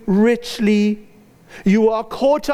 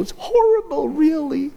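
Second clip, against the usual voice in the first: mock whine; 8 semitones higher than usual. Moaning about Monday morning.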